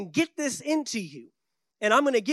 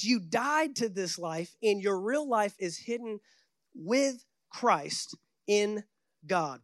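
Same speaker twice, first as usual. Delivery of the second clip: scripture reading; slower than usual. Acted. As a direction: draw each word out reverently.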